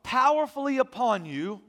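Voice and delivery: loud, high, ringing pulpit voice; full-force preaching. Acted. Pastor preaching loudly to his congregation, full force. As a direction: preach it out to the whole church.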